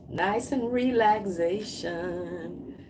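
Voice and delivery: very slow, drawn-out words fading off; languid, lingering delivery; dreamy relaxation. Acted. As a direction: stretch it out as if sinking into calm. Acts out relaxing with tea.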